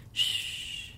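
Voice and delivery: soft unvoiced Shh; a lover's purring Shh; purred hush. A narrator's reading. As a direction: hush her gently with a breathy Shh. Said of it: "he purred".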